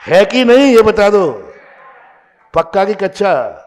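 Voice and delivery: loud, high challenge to the crowd; demanding call-out. Real, not acted. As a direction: call out for their agreement. Preacher demanding crowd's confirmation.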